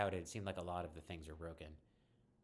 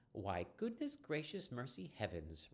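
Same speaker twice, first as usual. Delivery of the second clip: slow, higher goofy Southern-belle drawl; 5 semitones higher, slower than usual. Acted. [name] teasing Em about wanting a smoke, goofy drawl. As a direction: ham it up like a fussy old belle, drawn out. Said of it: "[name] says in a goofy drawl".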